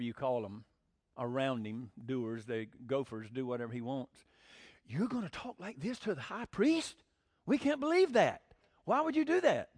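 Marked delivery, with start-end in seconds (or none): 4.9-9.7 high, scandalized mock outrage; indignant lackeys. The high priest's gophers scolding Paul, mock outraged. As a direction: play the shocked yes-men. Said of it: "His puppets asked Paul".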